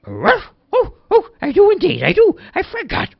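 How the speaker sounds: high, loud startled realization; high excited dog remembering; surprised dog. A narrator's reading. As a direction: realize with a jolt.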